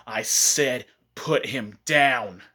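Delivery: slow, word-by-word command; deliberate, heavily weighted demand; firm repeated order. Retold or imitated. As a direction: space out each word as a final warning.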